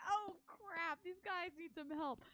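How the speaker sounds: mock alarm. Character realises friends need help, mock alarm. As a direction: blurt it in sudden alarm. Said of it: "and I'm like".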